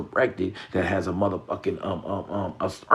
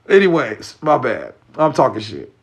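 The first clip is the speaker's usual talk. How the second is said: loud; 3 semitones higher, 9 dB louder than usual. Real, not acted. Loud self-correction after rant.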